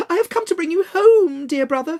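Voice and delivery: high, bright-eyed little sister calling her brother home; a gleeful little girl begging her brother to come home; little girl Fan. Acted. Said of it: "clapping her tiny hands and bending down to laugh".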